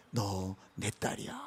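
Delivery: low, soft and slow; quiet, intimate voice of God; tender fatherly assurance. Acted. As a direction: gently claim your daughter, hushed and unhurried. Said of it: "우리를 바라보서만".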